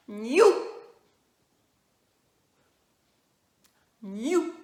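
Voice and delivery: very loud, rising "Niou"; teacher sounding out "Niou"; syllable drill. Shown as a demonstration. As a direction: call out "Niou" with a rising end. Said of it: "un petit peu plus complexe".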